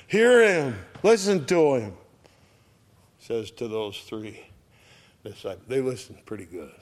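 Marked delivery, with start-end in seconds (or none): 0.1-2.0 loud, high acted command; commanding call. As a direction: call it out as an order from heaven. God's command from heaven acted out.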